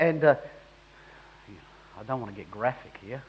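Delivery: usual speaking voice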